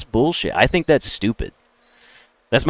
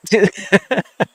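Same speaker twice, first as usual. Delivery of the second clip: high chuckle at own bluntness; laugh; 9 semitones higher than usual. Real, not acted. Speaker after calling people not as smart, high laugh. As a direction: laugh at what you just said.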